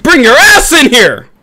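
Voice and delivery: high, loud taunts; taunting shout. Acted. Gamer taunting the other team, high and loud. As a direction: call them out to fight.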